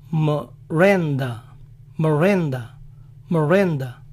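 Miranda as morenda, weak i. Shown as a demonstration. Showing how Americans weaken the i in Miranda. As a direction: swallow the i in Miranda. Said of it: "la vocal I se vuelve débil y dicen".

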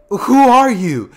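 high, loud startled question; surprised young Naruto. Acted. Naruto meeting a stranger, high startled voice. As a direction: blurt it out high and slow.

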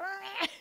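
high-pitched revving car noise; imitated engine roar. Storyteller imitates the pastor's car burning rubber, revving noise. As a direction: mimic a sports car tearing in.

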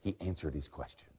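normal talking voice

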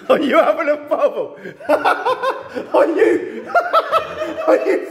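high incredulous laughing repeat; laughing disbelief. Real, not acted. Disbelief at the dice.

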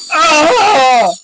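high-pitched wails at unbearable noise; pained screaming. Real, not acted. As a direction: cry out as your eardrums are assaulted. Screaming at ear-splitting noise.

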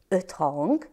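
ordinary delivery

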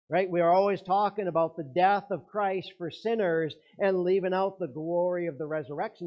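preaching at full force. Acted. Preacher urging the congregation, loud and insistent. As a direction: drive the point home to the congregation. Preacher presses the resurrection point.

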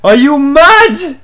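high, stretched, loud are you mad; outraged disbelief. Real, not acted. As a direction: shriek it out as if the game is insane. Outraged at the game.

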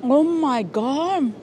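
slow, amazed gasp of taste; surprised. Real, not acted. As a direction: savor it in disbelief. First bite of strange fruit.

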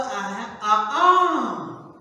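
ordinary talk, nothing marked

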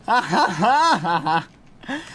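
usual speaking voice

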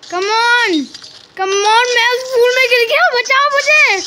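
high, loud cries for help; desperate yelling. Real, not acted. Gamer begging teammates to save him, loud yelling. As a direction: yell for rescue. Stuck falling into the flower.

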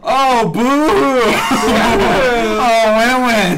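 loud, high-pitched jeer; mock booing. Real, not acted. Friend objecting to being left out of spoilers, mock booing. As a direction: boo your friends loudly in mock protest.

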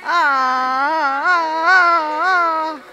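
loud effortful ah cries; straining cries. Real, not acted. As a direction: strain and shout through the pull. Tug of war effort cries.

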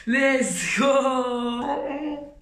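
celebratory shout. Real, not acted. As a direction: yell it out in triumph. Celebrating a win.

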